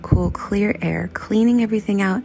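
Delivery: soft, unhurried meditation guide with whispered lines; calm breathing-exercise voice; guided meditation. Acted. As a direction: ease listeners gently through the body scan. Leads a breathing body scan.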